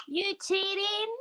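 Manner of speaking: high, drawn-out shocked accusation; gasp, then accuse in a high voice; playfully scandalised squeal; mock outrage.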